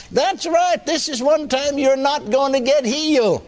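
high, insistent devil's voice. Retold or imitated. Devil tells man he won't be healed, high-voiced. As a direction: hold the devil's taunt up high, fall at the end. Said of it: "The fourth time he said".

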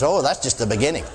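ordinary talk

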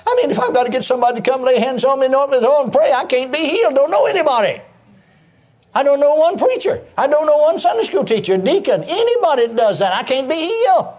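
high-pitched, distressed imitation of crying out; retold tearful plea. Preacher reenacting his plea to the Lord, mock-crying high lament. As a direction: plead upward, acting out past despair. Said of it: "I began to cry".

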